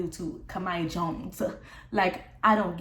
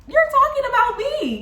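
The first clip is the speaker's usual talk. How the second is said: much higher and louder, You're hit hard; angry outburst; 8 dB louder than usual. Retold or imitated. Reliving the moment she yelled You're at Lena on the phone, much higher and louder. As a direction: fling the accusation at her.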